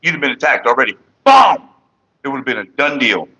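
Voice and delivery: ordinary delivery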